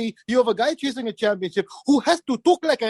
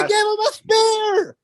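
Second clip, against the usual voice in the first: outraged shout; 9 semitones higher than usual. Real, not acted. Outraged repeat.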